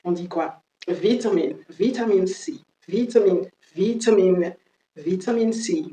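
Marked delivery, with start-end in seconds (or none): 0.9-4.6 British "Vitamine", short i in first syllable; British pronunciation. Shown as a demonstration. Teacher showing another way to say it, British accent. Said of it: "La prononciation british". 4.9-5.8 British "vitamine", short first i and a clear t; British pronunciation. Shown as a demonstration. Teacher showing the British version, British accent. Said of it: "La prononciation british".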